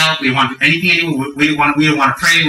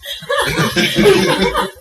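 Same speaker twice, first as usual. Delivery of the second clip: high-pitched laugh at oneself; high giggle. Retold or imitated. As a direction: giggle in place of an answer. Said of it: "And I was like".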